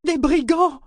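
very high cry of horror; horrified exclamation. A narrator's reading. Companion realises the coach is ambushed, crying out horrified. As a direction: shriek it in fright. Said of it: "s'exclama Keki, horrifié".

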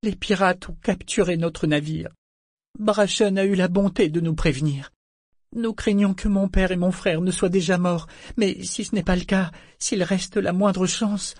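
high-pitched, tear-filled pleading; tearful. A narrator's reading. Malta tells Serwin of the captured ship, tearfully. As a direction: plead high, voice full of tears. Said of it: "Elle déclara d'une voix remplie de vraies larmes".

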